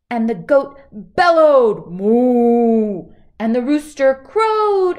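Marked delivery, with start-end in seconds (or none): 1.9-3.1 long loud moo; bull moo. Acted. Storyteller mooing loudly as the bull. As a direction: bellow it out like a bull.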